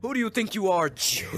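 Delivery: hammed-up outraged god; mock scolding. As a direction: tell off a misbehaving villager in jest. God scolds offending villager.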